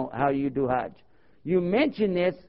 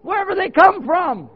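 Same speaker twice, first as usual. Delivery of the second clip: high, loud exasperated retort; imitated elder lady; 13 semitones higher, 9 dB louder than usual. Elder lady answering the baffled convert, imitated loud retort. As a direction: snap back as an indignant elder lady. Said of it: "one of the elders there in the community".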